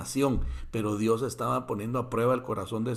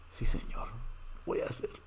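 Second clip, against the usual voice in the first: whisper; 8 dB quieter than usual. Acted. Abraham submits to God's command.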